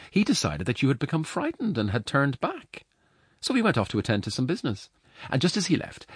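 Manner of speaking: high, lilting woman's voice; apologetic foster mother. Acted. Foster mother making excuses to the giant, high-voiced.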